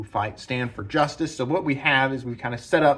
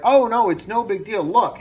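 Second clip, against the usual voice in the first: higher, louder, breezy no-big-deal voice; Beam brushing it off; 10 semitones higher, 5 dB louder than usual. Retold or imitated. Beam calming the angry soldier, breezily, in a higher, louder voice. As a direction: toss it off like it's nothing, a lively mock hero. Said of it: "Beam shows up and is like".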